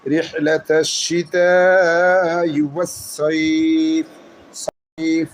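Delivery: very slow chant, সাইফ stopped without the kasra; very slow Quranic recitation at the stop; pause form recitation. Shown as a demonstration. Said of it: "তখন এই জেরটা বাদ যাবে".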